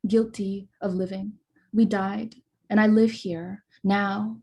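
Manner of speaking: slow, measured poetry reading voice; deliberate spoken-word delivery with pauses; poem reading. Acted. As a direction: read each line like an incantation, weighing it. Reads her poem aloud.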